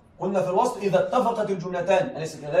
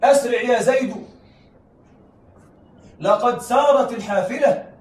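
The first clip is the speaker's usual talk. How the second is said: example called out; 4 semitones higher, 5 dB louder, slower than usual. Shown as a demonstration. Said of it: "أقول مثلًا".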